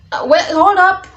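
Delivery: high, loud "wait, hold on"; taken-aback interruption. Retold or imitated. Halting the date in retold exchange.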